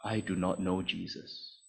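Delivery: low, hushed denial; quiet denial. Acted. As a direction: drop to a soft, sober restatement. Denial repeated softly after the oath.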